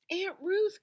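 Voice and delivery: high, loud eager call; crying out. A narrator's reading. Rosie calling out to Aunt Ruth at the door. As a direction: call out to her excitedly. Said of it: "cried Rosie".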